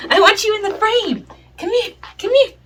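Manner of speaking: high, sing-song calling; high dog-talk voice. Real, not acted. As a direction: coax the puppy in, high.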